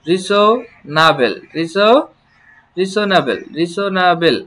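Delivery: রিসোনাবেল drawn out syllable by syllable; slow teacher demo; slow model word. Shown as a demonstration. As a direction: lay out each syllable for learners. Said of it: "teacher models the word".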